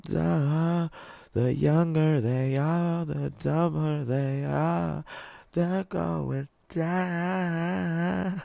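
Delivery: very high, loud, slow cartoon voice; hammed-up high mocking drawl. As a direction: stretch it out like a taunting cartoon character. Mock dramatic announcement of falling IQ.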